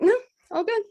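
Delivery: hammed-up chirpy no all good; mock innocent. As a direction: brush it off with a forced, high innocent voice. Feigning calm with tape on mouth.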